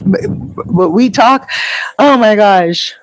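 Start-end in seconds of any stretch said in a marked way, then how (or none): none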